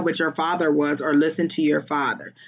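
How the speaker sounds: ordinary delivery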